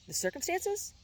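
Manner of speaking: high incredulous question; surprised. A narrator's reading. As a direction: act caught off guard. Chaz feigning surprise.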